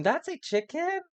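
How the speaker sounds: can't believe it's a chicken; surprised. Real, not acted. Streamer surprised that a prairie bird is a chicken.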